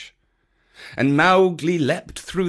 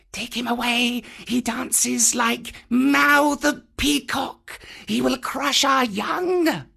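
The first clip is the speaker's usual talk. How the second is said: high, slow, strange wailing snake voices; whining cobras from inside the dome; cobras complaining; 12 semitones higher, slower than usual. A narrator's reading. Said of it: "said the cobras inside".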